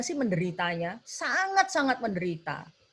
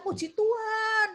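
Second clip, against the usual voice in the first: high, crying-out praise; healed Samaritan crying haleluya, voice much higher; tearful praise; 12 semitones higher than usual. Acted. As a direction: sob out your joyful thanks. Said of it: "teriak-teriak memuliakan Tuhan".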